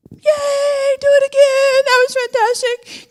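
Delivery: mock giddy Peter shrieking do it again; giddy cheer.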